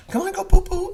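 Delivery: high sing-song mock coaxing; imitating ex-wife. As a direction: coax a toddler in a mock mom voice.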